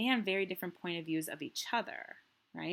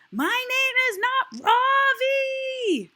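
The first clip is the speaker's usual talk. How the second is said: long, slow, much higher and louder yell; furious shout of his own name; shout at the top of his voice; 13 dB louder, much slower than usual. A narrator's reading. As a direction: bellow it out, stretching every word. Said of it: "shout at the top of my voice".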